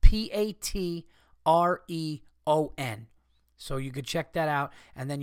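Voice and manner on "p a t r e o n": each letter said slowly and louder; slow, clear spelling of a website name; spelled out slowly. Shown as a demonstration. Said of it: "it's Patreon".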